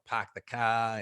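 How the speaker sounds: demonstrating a thick Boston accent. Shown as a demonstration. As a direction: drop the R's like an old-time Bostonian. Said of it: "My accent was so horrible".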